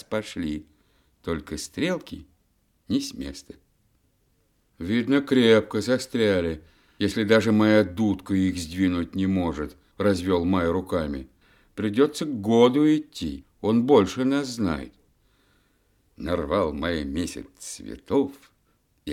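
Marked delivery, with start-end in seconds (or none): 4.8-14.9 louder, high puzzled voice; lightly voiced May. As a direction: shrug it off, then decide.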